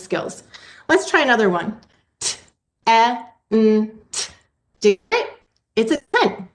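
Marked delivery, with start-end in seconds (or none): 2.2-4.3 T, E, N, T said as separate sounds with pauses; sounding out a word for students to blend; segmented sounds. Shown as a demonstration. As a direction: break the word into single sounds. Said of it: "how I segmented the sounds apart".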